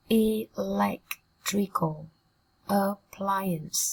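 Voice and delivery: very slow Electrical, pause, then appliance; slow broken-down reading. Shown as a demonstration. Teacher modelling a phrase for students, slowly in pieces. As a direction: sound out Electrical appliance in separated syllables. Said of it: "Cô giáo đọc chậm nhá".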